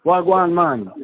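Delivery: casual Jamaican patois greeting; Jamaican accent. Retold or imitated. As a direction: toss off a Jamaican hello. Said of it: "they had the beautiful accent".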